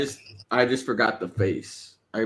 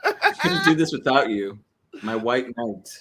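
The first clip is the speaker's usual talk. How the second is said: laughing mock gratitude. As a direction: gush through a laugh, teasing.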